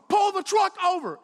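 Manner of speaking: very high, loud shouted order; insistent mom's yell. Retold or imitated. Mom overruling dad on the highway, insistent yell. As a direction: yell it as a furious demand.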